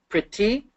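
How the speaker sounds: PRETTY in two beats, pre-tty, rising at the end; teacher's two-beat "Pretty"; syllable by syllable. Shown as a demonstration. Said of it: "two syllables".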